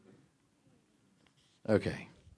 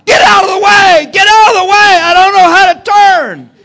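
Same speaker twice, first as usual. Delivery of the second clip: re-enacted full-volume yell, pitched way up; panicked hollering; 14 dB louder than usual. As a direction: scream a warning down the slope. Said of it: "I start hollering".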